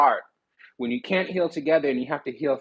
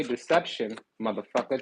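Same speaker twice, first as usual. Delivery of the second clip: normal talking voice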